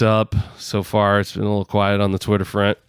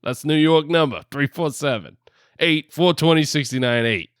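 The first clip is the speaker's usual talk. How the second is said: raised-pitch New York hotline plug; New York accent; 5 semitones higher than usual. Pretend. Host giving listeners the phone number, put-on New York accent. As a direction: read the number out like a New Yorker. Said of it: "still in this freaking New York accent".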